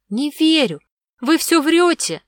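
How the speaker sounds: put-on high, loud childish accusation; indignant child's voice, high and loud; defiant little girl. A narrator's reading. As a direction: refuse to believe, drawing it out. Girl accuses villain of lying.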